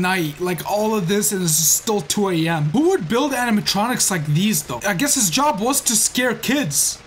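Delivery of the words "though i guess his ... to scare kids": normal talking voice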